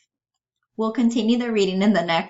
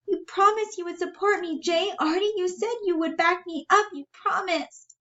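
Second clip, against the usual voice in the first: high-pitched hurt appeal; high, wounded character voice; betrayed Bella; 13 semitones higher than usual. Acted.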